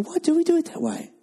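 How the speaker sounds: high, wondering inner voice; puzzled question. Retold or imitated.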